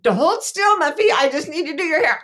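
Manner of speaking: put-on high, loud insistent little girl; toddler voice. Mom retells daughter holding her still, high bossy child voice. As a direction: boss mom around like a tiny stylist. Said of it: "And she's like".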